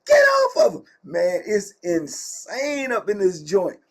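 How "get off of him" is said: high-pitched screamed plea, loud; shrieked imitation of a yelling girl; hollering girl. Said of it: "The girl hollering like".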